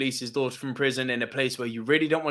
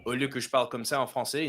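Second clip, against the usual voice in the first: quick, natural spoken French, a little lower and softer; speaking French; 4 semitones lower, 4 dB quieter, faster than usual. Shown as a demonstration. Frenchman showing how he speaks in France, in French. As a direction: switch into fluent native French. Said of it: "if I go there and I speak with this accent".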